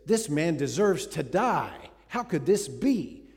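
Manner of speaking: loud, slow outrage at the injustice; an indignant king demanding justice; indignant outburst. Acted. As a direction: boom out the verdict in righteous fury. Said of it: "Oh, he's indignant".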